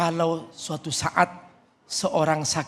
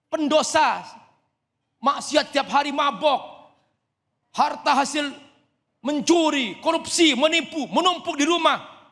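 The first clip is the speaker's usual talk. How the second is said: high, raised preacher's warning; fiery preaching; 9 semitones higher than usual. Acted. Preaching with rising force. (ceramah berapi-api tinggi)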